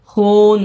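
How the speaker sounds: হুন drawn out slowly on the oo; long oo. Shown as a demonstration. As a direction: hold the oo of হুন to show its length. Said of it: "এরকম হচ্ছে".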